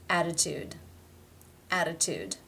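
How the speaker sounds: "Attitude" with the first T tapped like a D; flap T. Shown as a demonstration. Teacher comparing double-T words, flapped T in "Attitude". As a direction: tap the double T of "Attitude" into a D sound. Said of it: "it is the flap".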